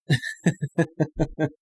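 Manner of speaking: choppy run of dry chuckles; scornful laugh. Real, not acted. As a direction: laugh it off in short, even pulses. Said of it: "I'm laughing".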